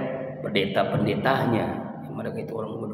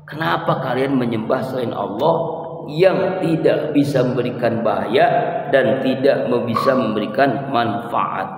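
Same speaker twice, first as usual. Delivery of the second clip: ordinary talk, nothing marked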